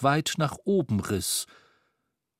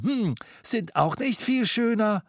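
Scrooge voice; 9 semitones higher than usual. A narrator's reading. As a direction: point at the socks. Scrooge hitting back.

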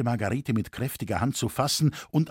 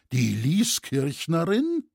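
higher, slow questioning exclamation; curious outcry; 4 semitones higher, slower than usual. A narrator's reading. Townsfolk learn who the disguised girl is, curious. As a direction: draw the name out, rising in curiosity. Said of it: "riefen neugierige Stimmen".

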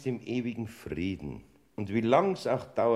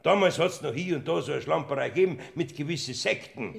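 agitated rush; 7 semitones higher, faster than usual. Acted. Portner rattles on agitated.

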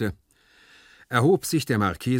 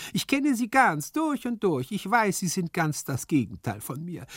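high, animated servant voice; know-all servant figure; 7 semitones higher than usual. A narrator's reading. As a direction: lecture the master point by point, high-pitched. Hyazinth voiced in the story. (hohe, belehrende Dienerstimme)

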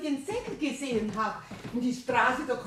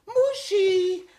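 calling her cat; 7 semitones higher, 7 dB louder, much slower than usual. Acted. Owner calls her cat.

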